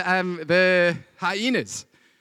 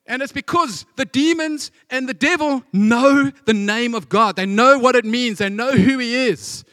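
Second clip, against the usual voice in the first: louder, rising preacher's drive; fervent preaching; 4 semitones higher, 4 dB louder than usual. Acted. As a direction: build the congregation up with conviction. Preaching at full force.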